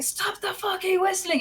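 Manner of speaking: high, strained mock yell; irate teammate imitated. Irate teammate at her whistling, high mock-angry yell. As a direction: imitate an enraged gamer at a high pitch. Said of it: "he got more and more irate".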